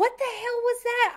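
high-pitched what the hell; incredulous exclaim. Real, not acted. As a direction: shoot up high in disbelief. Reacting to the grin.

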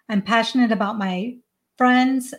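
usual speaking voice

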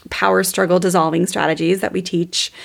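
ordinary delivery

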